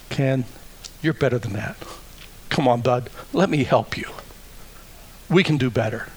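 soft, gentle coaxing; encouraging brother. Acted. The Spirit encouraging Ken to do better, softly. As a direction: coax him kindly, like an older brother. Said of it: "he says".

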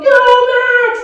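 loud, high sports-parent cheer; full-throated stadium cheer; cheering shout. Retold or imitated. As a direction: yell for your son at the game. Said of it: "And I'm going".

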